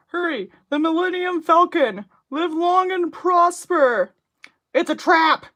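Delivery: loud, high hammed-up space hero shouts; mock sci-fi hero. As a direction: yell movie lines like a cheesy starship captain. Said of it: "She's saying ooh?".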